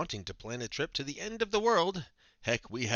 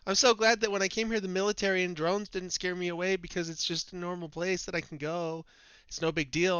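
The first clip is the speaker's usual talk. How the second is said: higher, louder boastful dude voice; mock bragging tourist; 5 semitones higher, 4 dB louder than usual. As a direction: brag like a dumb macho tourist. Mocking globe believer persona.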